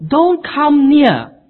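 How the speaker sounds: high, loud and slow; loud warning. Acted. Preacher voicing the holy smoke, loud slow warning. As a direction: boom it out as a warning.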